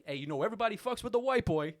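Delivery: high, loud buddy shout; Corey telling everyone; hyped regular. Retold or imitated. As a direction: brag about the clerk. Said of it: "Just like".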